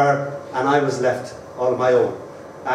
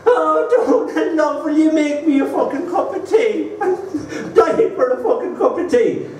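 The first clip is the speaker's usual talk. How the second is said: much higher, laughing mimic of a pleading Irish mum; mother's voice; 12 semitones higher than usual. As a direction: play his mum begging for tea, half laughing. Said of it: "So my mother would say".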